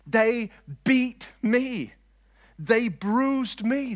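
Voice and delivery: "beat me" and "bruised me" drawn out high and loud; slow, heavy weight on "beat" and "bruised"; emphatic scripture reading. Acted. Preacher reading the watchmen verse, "beat" and "bruised" stretched emphatically.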